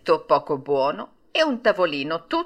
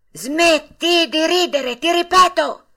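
slow, much higher and louder threatening shout; threatening yell; 9 semitones higher, 6 dB louder, slower than usual. A narrator's reading. Geppetto repeating his order to the puppet, threatening yell. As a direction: bellow the warning so he is obeyed. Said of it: "urlò con voce minacciosa".

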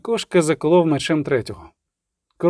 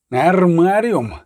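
long drawn-out angry cry; slow, forceful bellow, volume held in; roared demand; much slower than usual. A narrator's reading. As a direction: stretch the word into a roar. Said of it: "ревів він".

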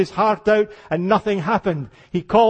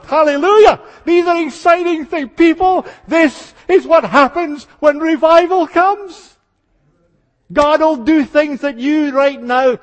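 preaching at full force; ringing revival shout; exultant praise; 7 semitones higher, 5 dB louder than usual. Real, not acted. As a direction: shout it, higher and louder.

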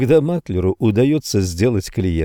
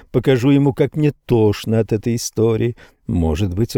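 plain reading voice

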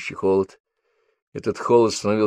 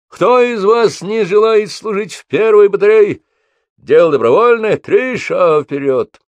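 shouting drill sergeant; 12 semitones higher, 8 dB louder than usual. A narrator's reading. Sergeant addresses recruits on parade.

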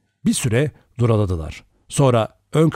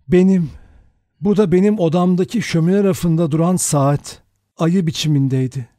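higher-pitched, shocked answer; strained, trembling voice; 7 semitones higher, 3 dB louder than usual. A narrator's reading. Vera recognises her clock as the weapon, voice trembling. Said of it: "titrek bir sesle".

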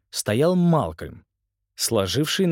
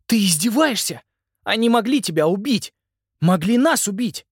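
angry; 10 semitones higher, 5 dB louder than usual. Acted. Simon furious at his brother.

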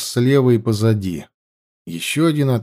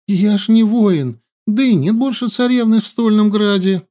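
tearful pleading; 10 semitones higher, 3 dB louder than usual. A narrator's reading. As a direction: keep whimpering the denial. Captive keeps pleading. (плаксивая мольба)

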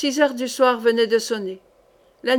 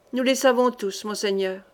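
plain reading voice

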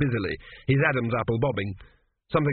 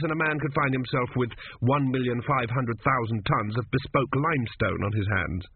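ordinary delivery

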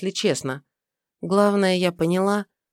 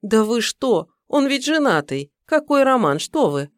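sincere indignation; 6 semitones higher, 3 dB louder than usual. Acted. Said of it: "Проговорила вполне искренне".

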